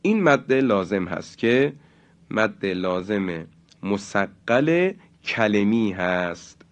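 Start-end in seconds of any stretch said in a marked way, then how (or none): none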